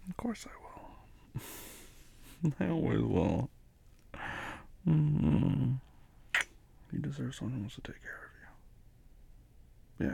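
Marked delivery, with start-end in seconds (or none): 0.1-1.4 slow, breathy promise; tender whisper. Real, not acted. Comforting sick girlfriend. 6.9-10.1 tender whisper. Real, not acted. Comforting sick girlfriend.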